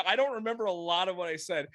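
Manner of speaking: ordinary talk, nothing marked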